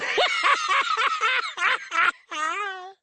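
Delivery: long, high, falling dummy cackle; evil cackle. Acted. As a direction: laugh long and wicked. Dummy final laugh.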